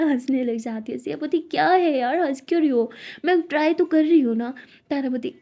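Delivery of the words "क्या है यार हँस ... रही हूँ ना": annoyed complaint. Acted. Siya annoyed at Tara laughing.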